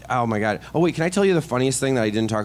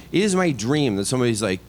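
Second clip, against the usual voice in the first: ordinary talk, nothing marked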